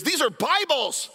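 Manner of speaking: high, slow exclamation from a guard; stunned guard barking out the discovery; stern border guard. Retold or imitated. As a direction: voice the guard catching them with Bibles. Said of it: "he looks at them and he goes".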